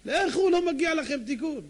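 high, loud, slow rebuke; stern dismissal. Acted. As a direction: refuse them firmly and loudly. Rabbi rejecting the crows.

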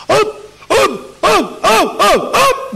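high, rhythmic "hop" noises; comic gobbling sound effect; imitated shovelling. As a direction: punch out high hops, one per ladleful. Mimics rapid shovelling with a ladle.

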